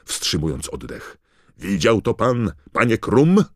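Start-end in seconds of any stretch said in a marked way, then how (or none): 1.6-3.4 higher, louder call with a rising question; excited commentator. A narrator's reading. Commentator calling to Krum as Harry flies, excited. Announcer calls out to the audience.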